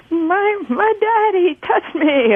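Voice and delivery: high, slow, weepy victim line; tearful TV victim. Retold or imitated. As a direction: play the crying TV victim.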